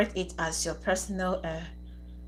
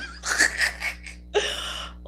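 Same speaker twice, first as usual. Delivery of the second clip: silent helpless laugh; breathless wheezing laugh. Real, not acted. Streamer laughing at a viewer called a fifty-year-old man, wheezing. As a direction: laugh till no voice comes.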